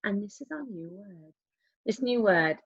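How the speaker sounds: ordinary talk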